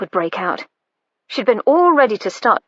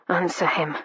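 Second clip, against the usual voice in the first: low murmur; 4 semitones lower, 6 dB quieter than usual. Acted. Hero urging quietly.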